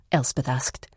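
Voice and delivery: ordinary delivery, nothing marked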